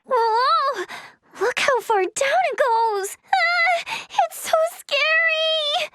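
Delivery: high, drawn-out alarm; scared squeal, stretching the words; frightened. Acted. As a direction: look down a dizzying drop in fear. Frightened by a deep drop.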